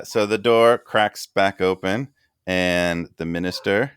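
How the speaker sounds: ordinary talk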